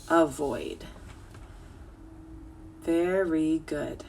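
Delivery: normal talking voice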